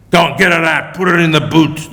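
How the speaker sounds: loud barked command; yelled order. A narrator's reading. Second man ordering her into the boot, loud yell. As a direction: yell it to your partner. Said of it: "the second man yelled".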